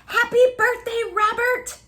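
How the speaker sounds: high, loud festive birthday cheer; cheery group greeting. A narrator's reading. Friends cheering Robert at his party, high and bright. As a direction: call it out like a crowd of party animals. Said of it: "said all of his friends".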